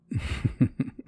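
pleased chuckle. Acted. Lover pleased with partner, breathy chuckle.

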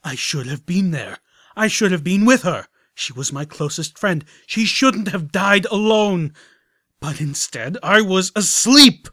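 high, loud grieving wail; anguished. Acted. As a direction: wail out the guilt and grief. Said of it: "his voice brimming with self-loathing".

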